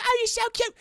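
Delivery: put-on high-pitched adoring child voice; cooing little girl. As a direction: gush over a cute pet like a little girl. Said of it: "and she's like".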